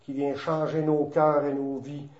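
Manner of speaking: low, softened praying voice; prayer. Real, not acted. Leads the closing prayer. (prière recueillie, voix plus basse et adoucie)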